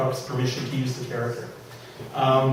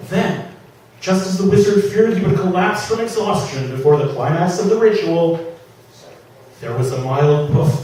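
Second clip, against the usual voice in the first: lively dramatic reading of a comic fantasy; story reading; 5 dB louder than usual. A narrator's reading. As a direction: read it to the room, savouring the jokes. Reads his novel aloud.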